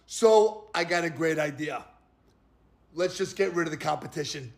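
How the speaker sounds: low, hushed mock-conspirator aside; mock scheming. Mock voicing of a political scheme.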